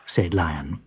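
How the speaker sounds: ordinary delivery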